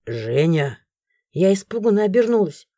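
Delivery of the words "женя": low stern call; stern man. A narrator's reading. Chris finding the creatures, low stern. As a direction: call her name darkly.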